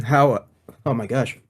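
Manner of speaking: ordinary talk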